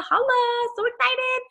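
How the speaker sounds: high, squealed mock celebration; excited cheer. Celebrating Vanessa's Ruby promotion.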